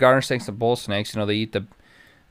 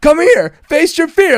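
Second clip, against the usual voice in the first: much higher, loud teasing call; taunting yell; 8 dB louder, slower than usual. Retold or imitated. Coworker chasing him with a snake, high loud taunt. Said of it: "And Ben's like".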